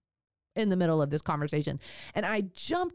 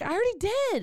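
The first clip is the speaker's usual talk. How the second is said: retort; 13 semitones higher, faster than usual. Retold or imitated. Retorting to the midwife mid-birth.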